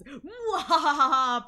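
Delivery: slow, drawn-out evil laugh; mad scientist laugh. Pretend. Villain laugh after gloating.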